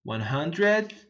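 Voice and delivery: one hundredth, slow teacher repeat. Shown as a demonstration. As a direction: echo one hundredth slowly. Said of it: "que chega no noventa".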